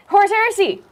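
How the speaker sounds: high, loud hyped-up shout; exclaimed chat message. Retold or imitated. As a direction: read it out like a battle cry. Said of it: "with three exclamation points".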